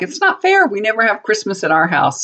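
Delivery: high-pitched imitation of a protest; mock complaint. Mother retelling her daughters' protest over Christmas hosting, mock complaining.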